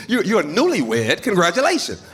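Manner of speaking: high, mocking congratulations; condescending old man. Old civil rights man teasing the young comic, condescending. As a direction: pat him on the head with it. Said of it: "he was very condescending".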